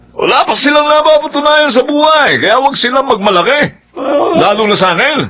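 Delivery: high-pitched, indignant outburst; angry rant. Acted. Father scorning his children's pride, angry rant. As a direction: raise the pitch and rail against them.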